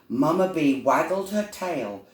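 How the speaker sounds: plain reading voice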